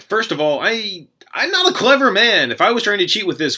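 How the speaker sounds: high-pitched, pleading self-defence; hammed-up protest. Player defending himself to judges, hammed-up protest. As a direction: act out an exasperated plea of innocence.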